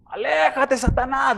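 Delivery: high, ringing mock shout at Satan; retold shouting. Imitating a preacher yelling at Satan to leave. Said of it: "le gritaba".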